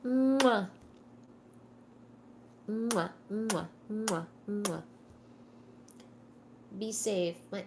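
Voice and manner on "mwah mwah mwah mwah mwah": blown kisses. Real, not acted. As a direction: blow kisses to the camera. Blowing kisses to viewers.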